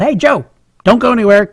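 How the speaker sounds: high, moderately loud mock yell; yelling mom. Mom calling her son to the phone, mock yelling. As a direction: imitate mom calling through the house. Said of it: "My mom answered it and yelled".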